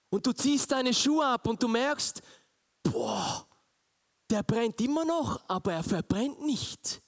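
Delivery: preaching at full force. Acted. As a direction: paint the scene with fervent preaching. Preaching the burning bush. (eindringliche, laute Predigtstimme)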